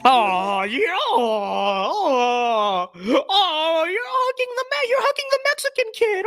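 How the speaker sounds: high, whimpering fake tearful gush; mock weepy liberal. Vlogger mocks residents hugging a child, high fake weepy gush. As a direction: sob it out in a syrupy falsetto. Mocking gushing with fake crying.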